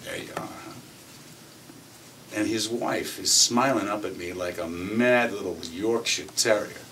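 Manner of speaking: ordinary talk, nothing marked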